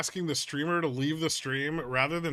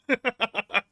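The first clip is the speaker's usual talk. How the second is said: laugh; 8 semitones higher, 5 dB louder than usual. Real, not acted. Laughs at chat joke.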